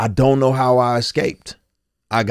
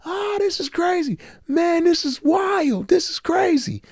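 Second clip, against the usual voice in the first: laughing mockery. A teen to his boys, mocking the scared driver, laughing high. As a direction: crow with high laughter, teenage bravado. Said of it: "me and my boys are laughing".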